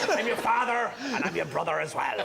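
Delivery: hammed-up villain quote; Darth Vader voice. Vader quote, West Country.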